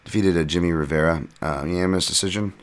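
usual speaking voice

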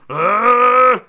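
high loud yelp; mock shriek. Host imitating a screaming corner, loud yelp. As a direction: let out a shrill cornerman yelp.

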